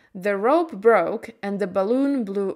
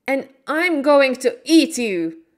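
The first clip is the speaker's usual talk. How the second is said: lion's angry threat, higher and louder; angry lion shouting, higher and louder; 5 semitones higher, 6 dB louder than usual. A narrator's reading. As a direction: roar the threat at the wizard. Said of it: "the lion shouted very angrily".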